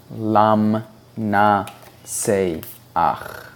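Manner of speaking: word list read syllable by syllable, each ending in "ach"; slow drill. Shown as a demonstration. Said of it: "we can practice with card sixty-six B".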